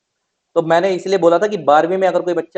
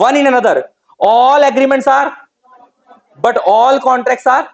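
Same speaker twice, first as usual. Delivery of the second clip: loud, high, slow drilling of the rule; emphatic teaching; 6 semitones higher, 6 dB louder, much slower than usual. Shown as a demonstration. Teacher drilling students on contracts, loud and slow. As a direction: spell out the rule slowly and loudly. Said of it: "teacher drills key rule".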